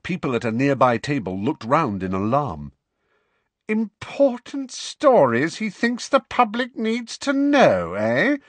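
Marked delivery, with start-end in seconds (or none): 3.7-8.4 high, loud mocking echo; mimicking Luna with scorn; withering mockery. A narrator's reading. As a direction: parrot the words back to sneer. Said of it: "she said witheringly".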